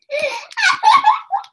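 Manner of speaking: high squealing laugh. Real, not acted. Stuck in game, laughing.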